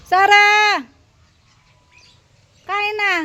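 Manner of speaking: loud, high call across the yard; shouting a dog's name to come eat. Real, not acted. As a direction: call the hiding dog from a distance. Calling dog again.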